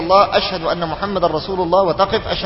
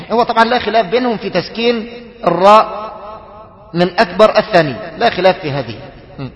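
ordinary delivery

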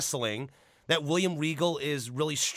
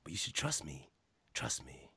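Jake the Snake voice; 11 semitones lower, 15 dB quieter than usual. Retold or imitated. Showing a friend how Jake the Snake spoke, low and hushed. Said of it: "he talk like this".

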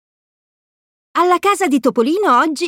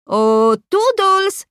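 higher, slow sing-song call; calling Toodles; 8 semitones higher, much slower than usual. A narrator's reading. Mickey calls Toodles for help, sing-song call. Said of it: "chiama Topolino".

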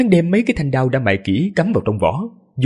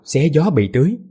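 plain reading voice

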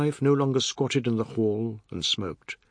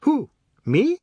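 much higher, louder, hammed-up alarm; mock horror; 7 dB louder than usual. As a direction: pretend to be scared stiff. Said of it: "With an expression of mock horror".

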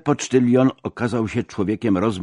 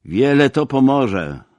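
slow, higher, louder retort; annoyed and drawn out; irritated; 7 semitones higher, 7 dB louder than usual. A narrator's reading. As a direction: snap back in slow exasperation. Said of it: "rzekłem, zdenerwowany".

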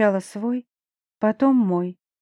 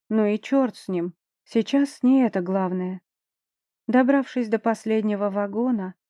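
usual speaking voice

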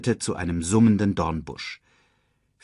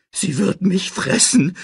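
high-pitched frightened screech; 11 semitones higher, 3 dB louder than usual. A narrator's reading. Pumba stuck under a trunk, screeching in fear. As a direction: screech it in terror of being eaten. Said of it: "kreischte Pumba".

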